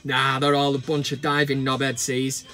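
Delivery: a dry, offhand ref brushing it off; mock referee. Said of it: "the ref goes".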